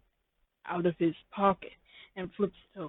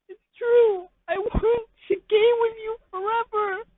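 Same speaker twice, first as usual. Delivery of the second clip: very high, wailing whine of the listener; high crying voice; 8 dB louder than usual. Acted. The listener confessing to Pimpich in a high crying voice. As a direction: squeak it out like a crybaby.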